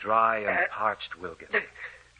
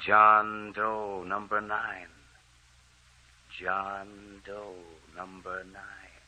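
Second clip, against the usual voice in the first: fading away; 5 dB quieter, slower than usual. Acted. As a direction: voice fading into silence. Ghost voice fades away.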